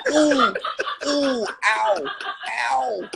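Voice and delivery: high, whimpering yelps of pain; mock crying in pain. Tyson recalling the opponent he hit, mock crying in pain. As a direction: imitate a hurt boxer crying out. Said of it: "I could hear him crying".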